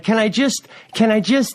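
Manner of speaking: high-pitched, mildly pleading question; acting out an awkward request to squeeze by; tentative asking. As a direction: cut it off, left hanging. Searching for coat under seats.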